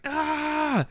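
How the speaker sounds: high, drawn-out mock scream; imitated scream. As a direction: imitate an anguished movie scream, held high. Imitates Superman's scream after the kill.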